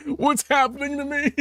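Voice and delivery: high, amused mock alarm; mock confusion. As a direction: play the baffled dream self with a laugh. Retold confused waking.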